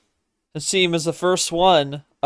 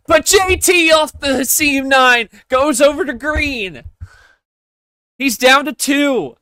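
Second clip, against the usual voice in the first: live play-by-play; 9 semitones higher, 4 dB louder than usual. Acted. Sustained play-by-play commentary.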